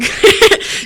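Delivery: high, breathy burst of laughter; quick amused laugh; short laugh. Real, not acted. Host teasing Eric the intern, laughing.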